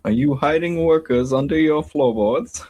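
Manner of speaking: high, hammed-up German accent; dodgy German accent. Co-host quoting the Nazi interrogator on request, dodgy German accent. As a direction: ham up a bad German accent, pitched high. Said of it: "the dodgy German accent".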